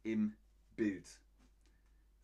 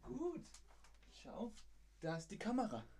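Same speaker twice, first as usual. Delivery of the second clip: soft, high sing-song coo; pet talk; 11 semitones higher, 7 dB quieter than usual. Real, not acted. Owner showing Bonnie the camera, soft high pet talk. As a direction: praise a pet sweetly.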